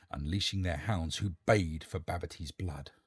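ordinary delivery, nothing marked

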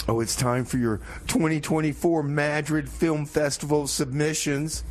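normal talking voice

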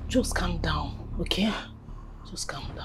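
hushed calming. Acted. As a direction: settle her down under your breath. Soothing the screaming wife.

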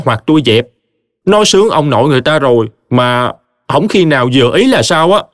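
indignant henpecked husband. Acted. Triệu venting to Khải about wife and mother, indignant. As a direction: list grievances to a sympathetic friend.

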